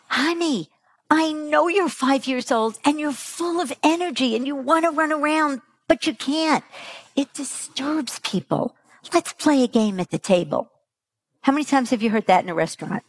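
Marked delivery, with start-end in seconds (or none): 0.1-10.7 patient parent. Retold or imitated. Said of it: "But do we say to them".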